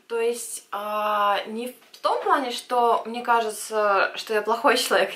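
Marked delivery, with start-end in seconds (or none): none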